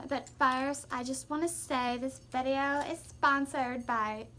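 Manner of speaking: overexcited influencer welcoming viewers; YouTuber voice. Pretend. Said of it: "I just had to make fun of a YouTuber voice".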